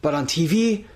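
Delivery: high, halting grand self-introduction; Del Rio impression. Host as Del Rio introducing himself, hammed-up. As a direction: announce the name like a showy wrestler. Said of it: "Do you wanna be A D R".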